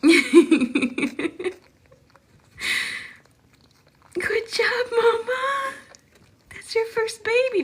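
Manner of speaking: high, slow, crying scream; joyful scream. Real, not acted. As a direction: scream it out with joy. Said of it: "that scream scared anybody".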